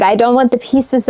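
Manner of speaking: ordinary delivery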